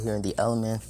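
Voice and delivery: plain reading voice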